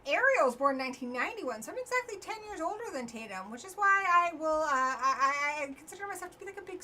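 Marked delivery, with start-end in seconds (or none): none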